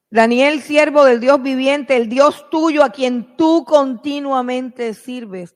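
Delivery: loud, high calling out to Daniel; king shouting anxiously down into the den. Acted. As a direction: shout it down into the pit, slowing toward the end.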